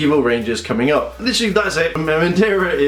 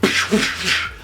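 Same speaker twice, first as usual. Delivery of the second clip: voiceless whooshes in quick bursts; imitated movement noises; 3 semitones higher than usual. Reviewer mimicking synchronised Megazord moves, whooshing noises. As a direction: whoosh out the robot's moves by mouth.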